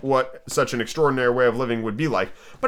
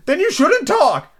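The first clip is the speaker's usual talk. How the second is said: high, loud, drawn-out retort; hammed-up eccentric rebuke; rude Hatter; 5 dB louder, slower than usual. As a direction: cut in loudly in a high voice. Hatter rudely cuts Alice off.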